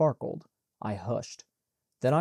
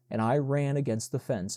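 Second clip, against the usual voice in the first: usual speaking voice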